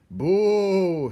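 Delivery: high, loud mocking boo; booing shout. A narrator's reading. Botticelli boos the weeping rat. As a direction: jeer at the rat. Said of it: "shouted Botticelli again".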